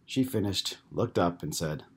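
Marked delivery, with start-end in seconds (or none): none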